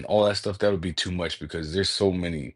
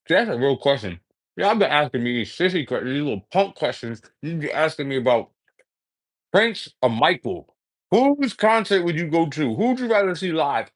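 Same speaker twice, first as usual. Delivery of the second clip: indignant rant; 7 semitones higher, 4 dB louder than usual. Real, not acted. Mock-scolding the audience.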